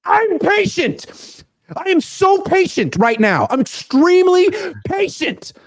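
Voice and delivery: high, slow sarcastic claim of patience; mock patient. Host sarcastically insisting to the chat that he's patient.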